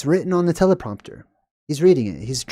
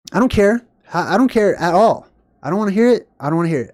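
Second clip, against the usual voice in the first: irritated outburst; 4 semitones higher, 3 dB louder, faster than usual. Real, not acted. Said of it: "Sorry, I'm just irritated".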